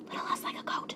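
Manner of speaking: breathy whispered repeat; whispered aside. Real, not acted. Vlogger whispers the aside to viewers. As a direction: whisper it under your breath. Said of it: "whispered aside".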